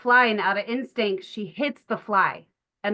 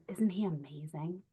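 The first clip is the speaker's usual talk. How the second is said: soft tender question; 4 semitones lower, 10 dB quieter than usual. Retold or imitated. Tender moment with crying child.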